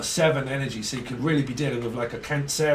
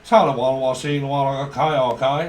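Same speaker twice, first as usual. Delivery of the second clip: southern drawl; 5 dB louder than usual. Retold or imitated. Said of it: "southern drawl".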